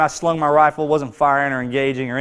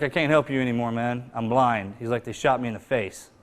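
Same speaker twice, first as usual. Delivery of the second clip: a low, hushed, broken retelling of a dying friend; half-voiced, quiet and hurt; hushed wounded friend; 3 semitones lower, 7 dB quieter than usual. To a SEAL buddy after the blinding shot, quiet and hurt.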